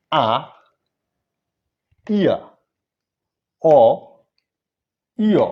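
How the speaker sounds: each vowel from আ to উ loud and separate, long pauses between; emphatic vowel-by-vowel recital, last উ as unrounded "eu"; pronunciation drill. Shown as a demonstration. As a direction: model the vowels for learners to repeat, ইয়া gliding from "i" to "a". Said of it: "আপনারা আমার সাথে একবার করে দেখেন".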